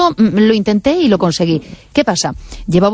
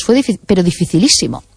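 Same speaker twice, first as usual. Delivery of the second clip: normal talking voice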